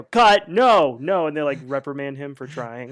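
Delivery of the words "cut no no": loud, sharp director's yell; shouted cut. Retold or imitated. Director stopping the actor from emoting, loud shout. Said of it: "and they go".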